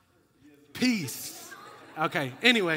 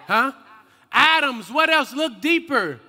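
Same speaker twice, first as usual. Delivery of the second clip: loud, raised call to the crowd; insistent prompting; 4 semitones higher, 6 dB louder than usual. Real, not acted. Preacher pushing listeners past atoms, insistent prompting. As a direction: fire it back, demanding more. Pressing the audience for answers.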